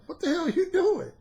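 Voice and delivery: mock the cousin's outrage; incredulous scold.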